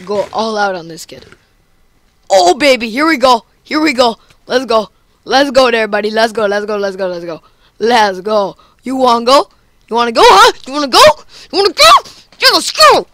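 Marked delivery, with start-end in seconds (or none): 2.3-13.1 hyped taunting. Real, not acted. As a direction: pump yourself up to goad the opponent. Hyped taunting fight opponent.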